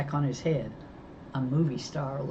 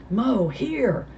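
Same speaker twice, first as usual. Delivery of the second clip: gasped; 7 semitones higher, 3 dB louder than usual. Acted. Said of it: "Dale gasped".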